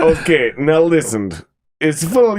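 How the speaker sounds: mock Russian accent, stern. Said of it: "He did the, the Russian accent".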